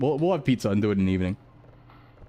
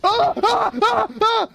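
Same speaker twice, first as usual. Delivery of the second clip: short, piercing, much higher and louder screams; real screams; 5 dB louder, slower than usual. Real, not acted. Jump scare in the game, streamer screams in terror. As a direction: shriek in sudden terror. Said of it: "I screamed that".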